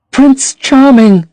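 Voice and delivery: high, slow and adoring; dreamy young woman. A narrator's reading. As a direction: breathe his name lovingly. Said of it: "she answered".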